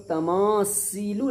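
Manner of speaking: तमासीलु with a long hissed s; wrong seen sound. Shown as a demonstration. Teacher forbidding this reading, stressed s sound. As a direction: lean on the s in तमासीलु, "see" not "thee". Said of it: "नहीं पढ़ना है".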